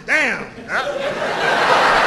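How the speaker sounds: high, loud cry of amazement; mock-astonished dealer; astonished outburst. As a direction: blurt it out, voice jumping up.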